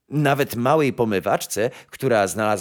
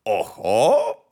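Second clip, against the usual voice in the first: rising, delighted courtier's cry; joyful exclamation; 3 dB louder than usual. A narrator's reading. Chamberlain mistaking a cow for the nightingale, joyful. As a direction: exclaim with pleased discovery. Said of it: "zawołał z radością szambelan".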